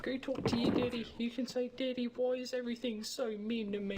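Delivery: mock crybaby voice. Mocking the player as a crybaby: high whiny child voice. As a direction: ham up a whimpering little kid.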